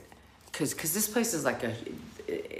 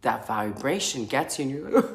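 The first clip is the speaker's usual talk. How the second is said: ordinary talk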